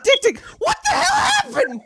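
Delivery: emotional shout. Shown as a demonstration. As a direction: scream the line with real emotion. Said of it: "put m-, emotion in it".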